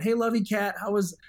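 put-on high, excited morning greeting to the kids; dad greeting his kids in the morning, excited; over the moon greeting. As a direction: greet them like you're thrilled to see them. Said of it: "I'm excited. My voice is raised".

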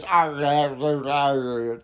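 mock West Country pirate growl on arr; a light cartoon Somerset rolling arr; imitating a Somerset accent. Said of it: "trying to imitate the Somerset accent".

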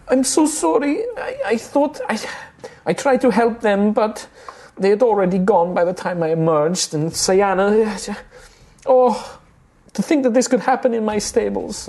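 high, tearful halfling woman, breaking over the words; tearful apology. Acted. Halfling stablehand apologising to the heroes, tearful and high. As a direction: pitch it way up and plead through the tears. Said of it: "wiping the tears away".

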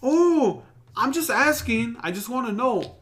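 high, sweetly innocent act; innocent waitress voice. Retold or imitated. Retelling how waitress dodged about his money, high fake innocence. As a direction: play dumb, all innocence. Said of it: "she was all like".

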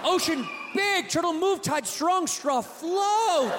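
triumphant caveman ranting; shouting caveman. Acted. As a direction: yell it like a caveman proving a point. Caveman climax.

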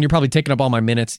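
normal talking voice

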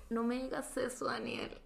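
very soft, deflated plea; quiet retold dismay; hushed disbelief. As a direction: say it under the breath, dreading bad news. Retold dread at failed purchase.

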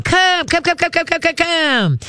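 high, bright coaxing call to a dog; excited dog call. Shown as a demonstration. Trainer calling a dog on a long line, high excited coaxing. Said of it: "start shuffling and clapping".

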